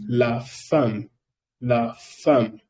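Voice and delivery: la femme said in French, fam with an open a; la femme pronounced fam, model for the class. Shown as a demonstration. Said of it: "Todo se pronuncia un".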